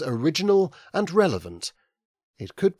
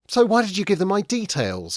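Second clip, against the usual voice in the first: higher, louder aggrieved complaint; complaining; 8 semitones higher, 4 dB louder than usual. A narrator's reading. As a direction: protest like you've been wronged. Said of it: "complained Ben".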